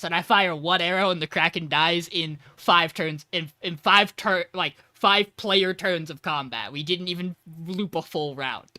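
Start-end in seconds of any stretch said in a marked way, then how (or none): none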